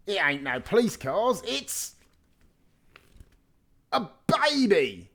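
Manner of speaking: put-on astonished, drawn out on baby; amazed discovery. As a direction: voice jumps high and loud on baby.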